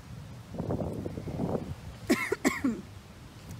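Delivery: dry cough from dust in throat. Real, not acted. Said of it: "got dust in my throat".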